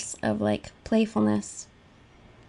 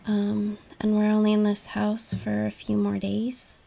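ordinary delivery

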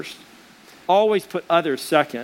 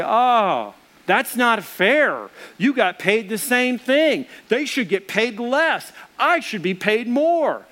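higher, slow, aggrieved whining; whiny complaint; 5 semitones higher, slower than usual. Retold or imitated. Early laborers grumbling at the landowner, high whiny complaint. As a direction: whine like a cheated worker.

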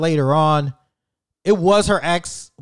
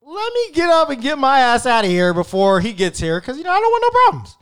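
much higher, louder, rushed panic; panicky exit; 10 semitones higher, 5 dB louder than usual. Retold or imitated. Telling the date he is leaving before the ex arrives, panicky and high. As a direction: squeak it out in a hurry to leave. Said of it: "I'm like".

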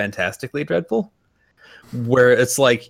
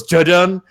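louder sung-out dramatic sting; mock dramatic sting; 6 semitones higher, 6 dB louder than usual. Mocking the villain's rearview mirror moment, hammed-up musical sting. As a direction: hum the cheesy movie sting. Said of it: "and it goes".

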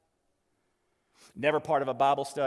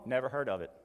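mildly deadpan, lower reply; offhand denial; 5 semitones lower, 4 dB quieter, much faster than usual. Retold or imitated. Thief answering the angel's doctrine question, offhand. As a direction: drop it low, as if clueless.

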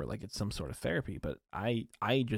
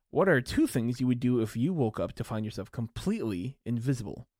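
ordinary delivery

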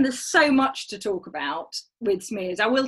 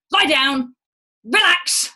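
loud, bossy doctor's order; barked order; 5 semitones higher, 7 dB louder, much slower than usual. Retold or imitated. Doctor barking at patient on the table, loud and bossy. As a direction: bark it like an impatient doctor. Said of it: "he was like".

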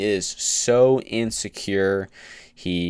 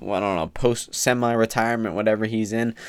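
ordinary talk, nothing marked

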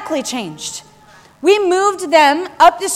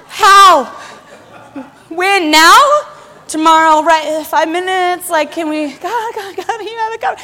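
high, rapid questions, half-laughing; mock-frantic questions, raised pitch; impatient pleading; 3 semitones higher, 4 dB louder than usual. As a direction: nag God like an eager child. Impatient self pleading with God.

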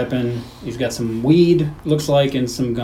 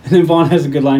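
ordinary talk

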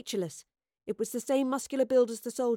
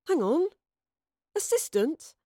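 surprised; 7 semitones higher, 4 dB louder than usual. Acted. Penny drops, indignant surprise.

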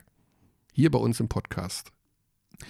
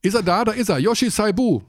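high, loud, happy hello; excited greeting; 8 semitones higher, 3 dB louder than usual. Real, not acted. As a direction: greet him with a big lift in the voice.

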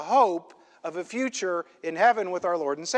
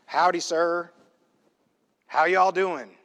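lightly voiced cowboy; a hint of cowboy talk; cowboy greeting; slower than usual. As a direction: tip your hat with a friendly howdy. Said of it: "start talking like a cowboy".

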